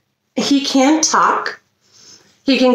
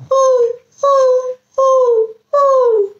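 high hooting owl; much slower than usual. Pretend. Imitating an owl.

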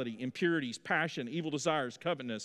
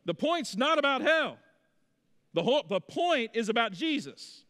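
urgent correction; 9 semitones higher, 3 dB louder than usual. Retold or imitated. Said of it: "And I'd be like".

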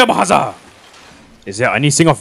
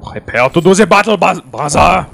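put-on German accent; 4 semitones higher, 3 dB louder than usual. Mock German rallying call.

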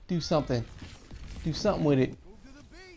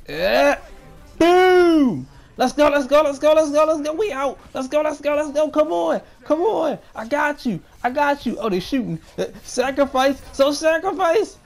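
loud, much higher victory shouts; victory yelling; 11 semitones higher, 11 dB louder than usual. Real, not acted. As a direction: scream with joy as the game is won. Clutching the winning flag capture.

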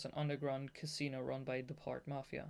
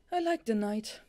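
higher, louder put-on character voice; Dazai's voice; 8 semitones higher, 7 dB louder than usual. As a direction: voice a cool man who loves night. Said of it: "Daza said".

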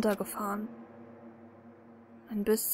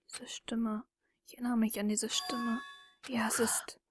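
whispered, softer; hushed recognition; 6 dB quieter than usual. Acted. Dreaming narrator recognizes the voice, hushed whisper. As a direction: whisper it like a half-remembered memory.

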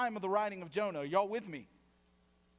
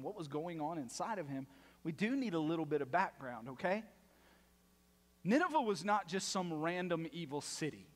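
ordinary talk

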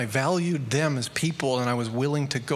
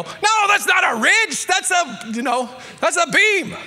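much higher, louder, exasperated correction; foreman yelling; 7 dB louder than usual. Retold or imitated. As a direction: shout down the wrong piece at the kid. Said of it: "And he'd go".

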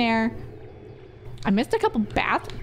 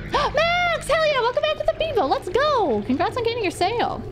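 high, fast, hyped-up welcome shout-out; hype streamer greeting; 12 semitones higher than usual. Acted. Streamer greeting returning viewer Max, high and hyped. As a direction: greet the regular like a party host.

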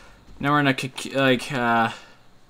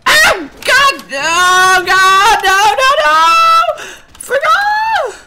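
high frantic panicked screams; terrified gibbering; 9 dB louder, much slower than usual. Real, not acted. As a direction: scream in terror while running. Chased, screaming.

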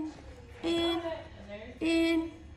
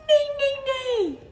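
high loud neigh; whinny like a horse; 14 semitones higher, 3 dB louder than usual. Pretend. Parent voicing horse to toddler.

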